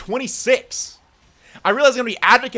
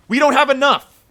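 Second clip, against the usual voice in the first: higher and louder angry cry; real anger; angry exclaim; 6 semitones higher, 5 dB louder than usual. Real, not acted. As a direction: shout the shortfall out in frustration. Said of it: "I'm sorry for how mad I'm getting".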